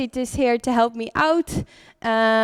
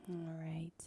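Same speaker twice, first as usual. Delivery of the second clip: soft unvoiced whisper; 6 semitones lower, 20 dB quieter than usual. Real, not acted. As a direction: breathe the words without voice. Whispered cue during breathing.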